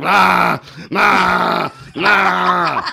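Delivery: loud braying nah, like a donkey. Retold or imitated. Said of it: "she was like a donkey".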